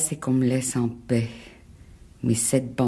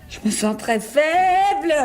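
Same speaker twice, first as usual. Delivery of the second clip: higher, louder, slow tearful plea; on the verge of tears; 4 dB louder than usual. Acted. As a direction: let the voice break into tears. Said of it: "faut pas pleurer". (au bord des larmes, voix qui se brise)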